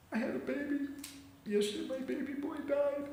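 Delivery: high-pitched acted crying; tearful lament. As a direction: wail it high as a grieving mother, without shouting. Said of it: "she was crying".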